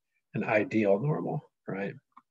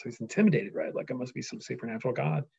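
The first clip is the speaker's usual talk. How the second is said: ordinary talk, nothing marked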